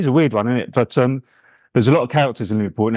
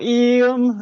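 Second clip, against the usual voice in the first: a light Scouse "Ian", pitched up high; Scouse imitation; 13 semitones higher than usual. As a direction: toss off a cheeky Scouse "Ian". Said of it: "Giving it the old".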